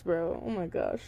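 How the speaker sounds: plain reading voice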